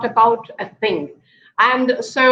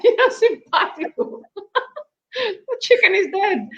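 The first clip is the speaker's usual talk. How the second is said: talking through laughter; giggling while retelling the punchline; laughing; 9 semitones higher than usual. Real, not acted. Retelling the chicken joke to the host, laughing.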